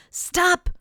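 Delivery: the guests screaming at the moose; screamed. Acted. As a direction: yell it to halt him. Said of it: "screamed his guests".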